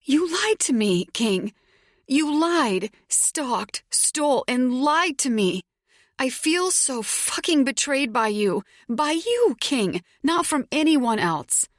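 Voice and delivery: high, loud and near tears; tearful angry accusation. A narrator's reading. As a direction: accuse him, voice cracking with hurt.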